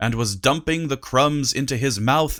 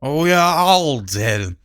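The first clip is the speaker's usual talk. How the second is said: put-on drunk slur; 4 dB louder, slower than usual. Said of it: "he swayed".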